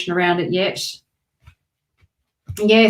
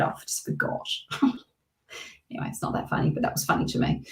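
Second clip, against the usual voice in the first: whispered giggle; 10 dB quieter, much faster than usual. Real, not acted. Laughing off her own mistake.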